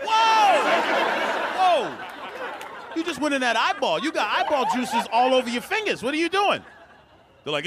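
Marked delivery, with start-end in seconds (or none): 0.0-6.6 alarmed shout. Retold or imitated. Germ-aware guy yells at snacking friend, alarmed. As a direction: yell at the eyeball-touching friend. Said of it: "I'm like".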